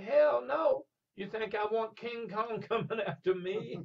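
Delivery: high loud mock retort from the cabbie; old cabbie scoffing. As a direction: toss it off like a spooked old cabbie. Old cabbie retorts, teller voices him.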